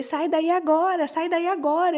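marido urgente imitado, mais agudo; ordem urgente. Retold or imitated. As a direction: imite alguém mandando sair já. Marido manda sair da prova. (ordem urgente imitada, em tom agudo)

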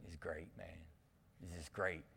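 very low, very soft lazy drawl; Matthew McConaughey drawl. Retold or imitated. Pastor imitating the kid praising the hike. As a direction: sink the voice way down, totally chill. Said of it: "but he talked like this".

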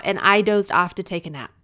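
ordinary delivery, nothing marked

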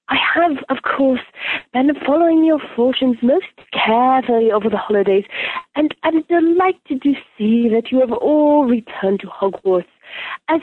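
put-on a young-sounding mystic teacher, savouring each phrase; Professor Trelawney. Welcoming students back to Divination, airy Trelawney impression. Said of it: "impersonating Professor Trelawney".

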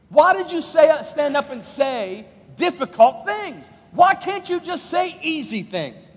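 loud, high exasperated whine; mock complaint. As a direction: whine at Jesus like a let-down fan. Crowd complains to Jesus.

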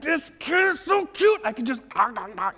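high, squeezed cute-aggression gushing; gushing over a child. Acted. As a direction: coo as if you could gobble the kid up. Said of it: "see your kid and you're like".

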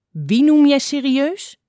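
loud, slow, indignant growl; drawn-out grumpy old fox; growled challenge. A narrator's reading. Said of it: "gromde een stem van achter".

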